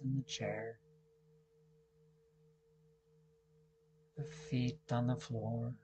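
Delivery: very soft, calm meditation voice; guided meditation. Real, not acted. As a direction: guide them into stillness, hushed, with long pauses. Leads a seated meditation.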